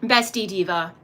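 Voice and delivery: ordinary delivery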